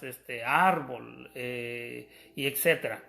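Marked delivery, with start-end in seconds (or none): 0.4-1.3 "árbol" slow and low, accent on the á; stress on first syllable. Shown as a demonstration. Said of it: "igual que otras palabras". ("árbol" lento, con acento en la primera sílaba)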